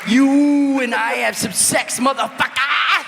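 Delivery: high, loud, stretched Scottish yell; hammed-up Scottish holler; fake Scottish accent. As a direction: drawl it loudly in mock Scottish. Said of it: "you have to hold out".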